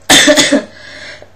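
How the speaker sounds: hacking cough; loud cough. Real, not acted. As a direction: cough harshly. Coughing from a cold.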